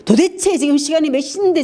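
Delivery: the wrong, harsh way to greet lateness; harsh scolding. Shown as a demonstration. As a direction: scold a late spouse harshly. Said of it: "지금 이렇게 해가 아니라".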